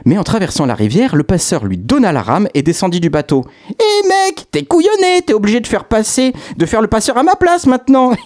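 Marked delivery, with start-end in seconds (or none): 3.7-8.1 very high, mocking street voice; cocky ferryman. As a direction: jeer at him like a cheeky lad. Ferryman mocks the king.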